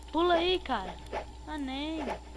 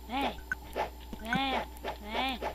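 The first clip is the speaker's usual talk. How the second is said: normal talking voice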